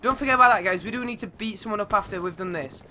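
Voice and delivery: ordinary delivery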